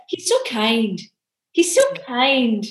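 put-on mixed Scottish and Northern Irish accent; a terrible go at a Scottish-Northern Irish accent; amateur Scottish-Irish impression, drawn out on the repeat; Alan Scott. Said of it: "That was my terrible Alan Scott impression".

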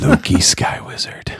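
breathless whisper, slow and savoring; whispered name. Real, not acted. Whispering the kid's chosen name to cohosts, slow and hushed. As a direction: hush the name like a secret, slowly.

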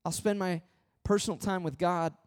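ordinary talk

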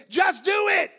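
very loud, high preacher's shout; shouted exhortation. Real, not acted. Preacher urging church to act, shouted exhortation. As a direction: belt it out as a command.

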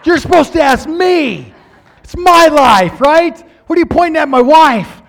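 loud, high, frantic protest. Retold or imitated. Dreamer protesting to the preacher, loud and high. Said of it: "I thought".

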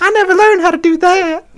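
very high falsetto Shaq voice; Shaquille voice. Retold or imitated. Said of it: "his real speaking voice".

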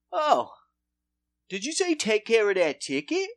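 spring the trap with mock innocence; sly Commish. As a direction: commish calling his officer in on the briber.

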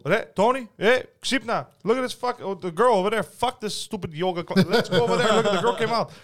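high, loud eager call; excited calling out. Retold or imitated. Calling the landlord over to look, in a high, loud excited voice. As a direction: call your buddy over to see the girl. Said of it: "I go".

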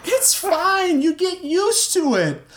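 high, urgent reassuring yell; very high, strained voice; strained assurance. A narrator's reading. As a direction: reassure while shouting through a struggle. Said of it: "trying to assure him".

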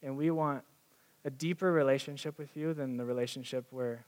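gentle closing prayer with pauses. Acted. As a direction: pray humbly for the room. Leads the room in prayer.

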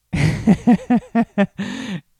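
loud, high burst of laughter; real laugh. Real, not acted. Husband amused by grocery store story, loud laugh.

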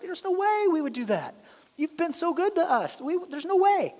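high, pleading disbelief; incredulous. Retold or imitated. Brothers protesting their innocence, in high pleading disbelief. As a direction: brothers insisting they'd never steal. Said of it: "These guys are incredulous".

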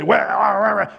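high wordless mock rant; mock mean ranting. Showing what Jesus didn't say to the sinner, mock nasty rant. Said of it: "being mean and nasty".

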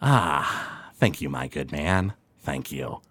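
low, soft, contented gentleman; Anandral Sin. Acted. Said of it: "Anandral Sin picks it up".

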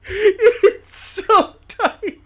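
high, loud, stretched words through laughter; cracking up. Real, not acted. Co-host teasing the host's introduction, cracking up. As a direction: squeal it out mid-laugh.

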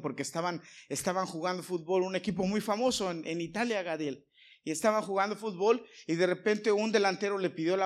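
full-force preaching. Real, not acted. Pastor warns the church about division, impassioned sermon voice. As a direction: pastor drives home the need for unity to his congregation, preaching loudly.

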